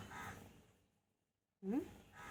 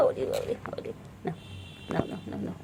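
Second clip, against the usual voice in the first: whispered coaxing; 11 dB quieter than usual. Real, not acted. Whispering to pet.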